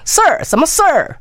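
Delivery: 事儿 and 什么事儿 said high with a heavy curled 儿; exaggerated erhua. Shown as a demonstration. Host mocking the mainland accent, heavy erhua. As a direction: overdo the retroflex 儿 on 什么事儿. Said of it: "因就讲什么事儿".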